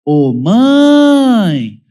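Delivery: high, loud tattling "Ô, mãe!" with a rise and fall; child calling mother to accuse sibling, whiny call; accusing child's call. Retold or imitated. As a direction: yell "Ô, mãe!" like a kid reporting a brother. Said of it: "normalmente acompanhado de".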